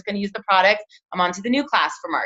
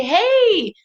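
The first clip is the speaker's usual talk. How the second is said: high, cheery "Hey" to a new recruit; mock-cheerful hello on a follow-up call; bright greeting; 11 semitones higher, 4 dB louder than usual.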